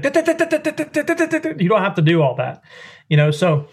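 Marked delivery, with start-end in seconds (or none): 0.0-1.6 high, rapid staccato dits mimicking a rushed call; mock fast quack string, pitched way up; mock rapid duck call. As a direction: imitate the overblown fast cadence people think is right. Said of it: "People think".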